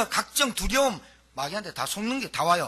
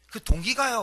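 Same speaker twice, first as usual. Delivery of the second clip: ordinary talk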